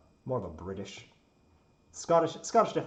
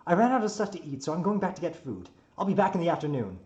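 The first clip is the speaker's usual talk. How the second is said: higher, louder breezy announcement; cheerful builder; 7 semitones higher, 7 dB louder than usual. Acted. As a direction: call out brightly on the way off. Quotes the builder's departing words.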